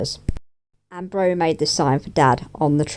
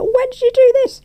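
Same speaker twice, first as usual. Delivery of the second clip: much higher and louder, stunned question; shocked dad; 5 dB louder than usual. Retold or imitated. Dad stunned to find a homemade cake, high and loud. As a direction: play the dad caught totally off guard. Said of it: "He's like".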